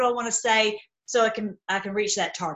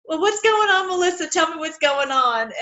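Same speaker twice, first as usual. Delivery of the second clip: imitating a fretting mom, pitched higher; worried mom; 6 semitones higher, 4 dB louder than usual. Mom asking Melissa what's going on, fretful and higher. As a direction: play Mom pestering her daughter, higher and anxious. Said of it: "she's like".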